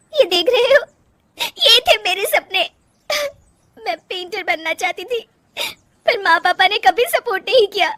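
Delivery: high, sobbing voice with gasps between phrases; acted crying, breaking into sobs at the end; tearful outpouring. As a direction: pour it out through tears, pitched high. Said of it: "फूट फूट कर रोने लगती है".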